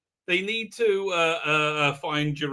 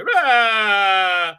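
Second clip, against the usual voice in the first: loud mock monster roar; 4 semitones higher, 9 dB louder than usual. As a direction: roar out like the creature grabbing her. Monster attacks in retold scene.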